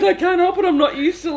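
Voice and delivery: high, laughing imitation of an excuse; mock helpless protest. Colleague excusing her constant looking up, mock helpless protest.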